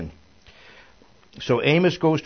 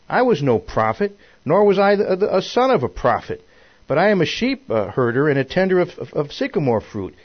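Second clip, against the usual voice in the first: raised, loud declaration in the prophet's words; insistent scripture reading; 6 semitones higher, 6 dB louder than usual. A narrator's reading. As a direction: proclaim the humble origins emphatically. Reads prophet's answer from scripture.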